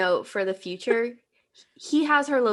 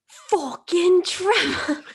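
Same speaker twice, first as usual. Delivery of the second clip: put-on British accent; 9 semitones higher, 5 dB louder, much slower than usual. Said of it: "you have to do it in his accent".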